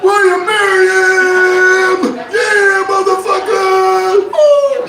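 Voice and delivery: over-hyped hype-man shout, very high; rapper's sidekick screaming backup at a proposal. Acted. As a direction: holler it sky-high, stretching every word, then whoop. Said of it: "He's gotta go hype, hype it up".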